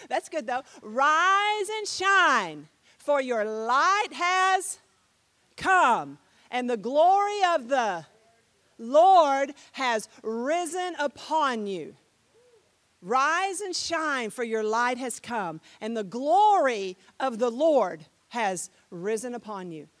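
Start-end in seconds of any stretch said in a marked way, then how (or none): 0.8-12.0 loud, very slow proclamation; grand, drawn-out Bible recital; scripture declamation. Shown as a demonstration. 13.1-19.9 solemn Bible recital; scripture declamation. Shown as a demonstration. Proclaims the verse a second time.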